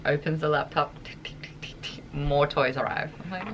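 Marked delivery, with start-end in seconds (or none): 1.0-2.1 tiny unvoiced tongue ticks; ticking sound. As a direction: imitate soft, toneless keyboard tapping. Imitates dog typing on laptop.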